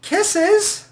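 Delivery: high, sassy sign-off; mock kisses. Signing off to the commenter with high sassy kisses. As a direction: blow her a sassy high kiss.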